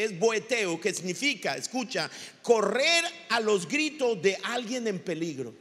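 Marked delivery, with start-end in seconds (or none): none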